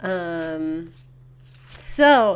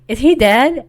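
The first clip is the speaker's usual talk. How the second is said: alarmed question; 4 semitones higher, 9 dB louder than usual. Retold or imitated. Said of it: "Chris is like".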